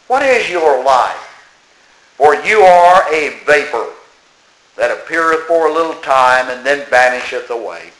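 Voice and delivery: emphatic, measured Bible reading with deliberate pauses; Scripture reading. Acted. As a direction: read the verse out firmly, slowing on key phrases. Reads Scripture aloud to congregation.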